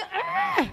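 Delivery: a sudden high squeal; high mock shriek. Fed-up mother squealing at the kids, high mock shriek.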